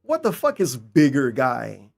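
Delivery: ordinary talk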